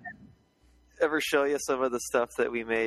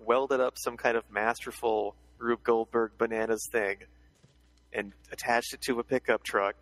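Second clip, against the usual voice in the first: ordinary talk, nothing marked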